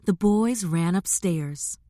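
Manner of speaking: usual speaking voice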